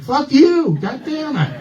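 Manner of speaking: very high-pitched mock screech; pissed-off wife. Wife learning it was a prank, furious. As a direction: imitate an outraged cursing yelp, way up high. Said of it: "My wife was so pissed".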